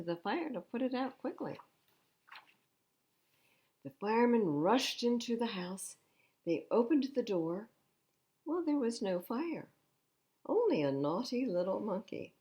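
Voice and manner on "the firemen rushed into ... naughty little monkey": gentle storybook reading; reading to children. Acted. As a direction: read it like a bedtime picture book. Reads picture book aloud.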